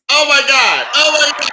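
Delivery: high, loud squeal of delight; high excited gushing; overjoyed. Real, not acted. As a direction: gush with thrill. Guest overwhelmed at entrance.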